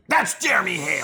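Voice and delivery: high mocking imitation of her; squeaky smug answer.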